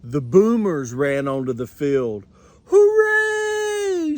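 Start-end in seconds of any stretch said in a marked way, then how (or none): 2.6-4.2 very high, louder hooray; fans cheering. Acted. Fans cheer the Mighty Mites taking the field, very high. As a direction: roar as the team runs out. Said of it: "shouted the fans".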